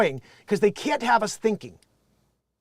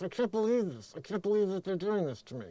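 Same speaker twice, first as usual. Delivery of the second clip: much softer, talking through a clenched jaw; soft, clenched-teeth lockjaw imitation; jaw wired shut; 9 dB quieter than usual. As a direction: mimic a woman whose jaw is wired shut. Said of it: "she's talking like this".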